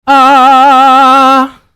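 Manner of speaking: loud sung ah held in the throat; throat sound. Shown as a demonstration. Vocal coach repeating the ah placed in the throat. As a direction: sing a strong ah from the throat. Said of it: "en la garganta".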